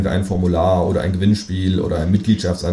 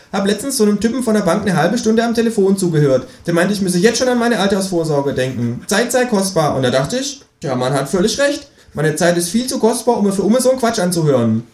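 higher, cheeky kangaroo voice; 11 semitones higher than usual. A narrator's reading. The kangaroo explains its premium number to the narrator, high and smug.

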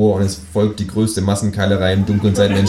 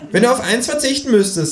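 indignant kangaroo. Acted. Kangaroo outraged at eating table decoration, high voice. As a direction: huff at the stupid decoration. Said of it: "sagt das Känguru".

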